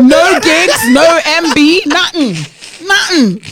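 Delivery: loud mocking rundown of empty offerings; mocking list. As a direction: tick off what's missing, scornfully. Mocking the in-person persona.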